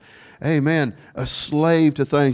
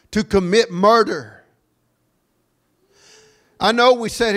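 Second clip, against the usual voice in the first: high, ringing phrases with long pauses; forceful preaching; 8 semitones higher, 4 dB louder than usual. Real, not acted. Preacher on killers enslaved to sin, raised forceful preaching. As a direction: proclaim it phrase by phrase.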